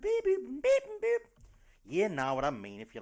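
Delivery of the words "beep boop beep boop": high, loud robot beeps; squeaky electronic beep-boop noises; mock beeping. Host opening the show with high mock beeps.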